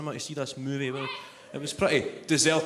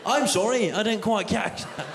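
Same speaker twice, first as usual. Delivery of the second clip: higher-pitched mock recorded apology; automated booking line; 5 semitones higher than usual. Booking line still baffled by the caller, high automated-voice imitation. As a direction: imitate an oblivious phone robot, pitched up. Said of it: "the automated cinema ticket booking line".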